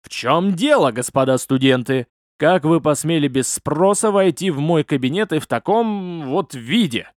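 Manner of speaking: plain reading voice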